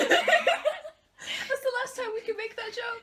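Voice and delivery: high, loud and laughing; laughing shout. Real, not acted. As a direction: crack up while calling out. Laughing cry over the joke.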